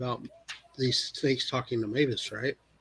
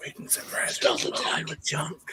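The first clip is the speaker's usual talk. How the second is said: hushed plotting whisper; 2 semitones higher than usual. Acted. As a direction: whisper the plan to your companions. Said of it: "why are we whispering".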